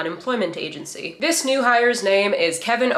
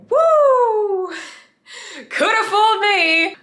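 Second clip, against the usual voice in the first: very high, loud whoop of fake astonishment; mock amazement; 12 semitones higher, 3 dB louder, much slower than usual. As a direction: squeal it in exaggerated disbelief. Mock amazement at brownface casting.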